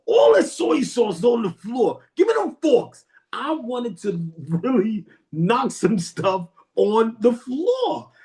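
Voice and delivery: high-pitched, drawn-out ranting; mock mad. Customer imagining wrecking the counter, high mock fury. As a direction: rant slowly with rising, hammed-up outrage. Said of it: "I was so mad".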